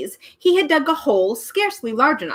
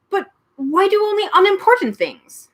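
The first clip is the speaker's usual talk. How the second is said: Milo's higher voice; 11 semitones higher, 4 dB louder than usual. A narrator's reading. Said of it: "asked Milo".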